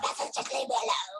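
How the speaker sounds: high, airy plea to be left alone; a mock fed-up plea; mock exasperated plea. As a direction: brush everyone off in a put-on voice. Herself when about to shout.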